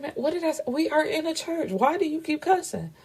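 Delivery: very high-pitched mock telling-off; exasperated scolding. Woman telling her man off for swearing in church, high exasperation. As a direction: scold him high up, incredulous, without shouting.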